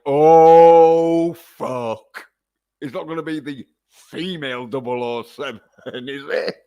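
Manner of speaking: loud dismayed oh then falling off; mock dismay. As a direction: groan of dismay. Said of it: "then it'd been like".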